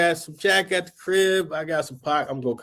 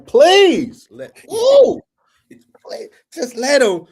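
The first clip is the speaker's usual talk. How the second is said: much higher, pleading mock prayer, voice cracking up; begging prayer; 11 semitones higher, 5 dB louder than usual. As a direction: beg the Lord in a strained high voice. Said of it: "I'm praying".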